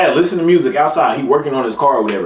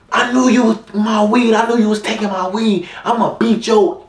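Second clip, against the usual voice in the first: higher, scolding angry mom voice; mad mom impression; 8 semitones higher than usual. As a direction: play a furious mom catching her son. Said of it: "I can't really impersonate it for real".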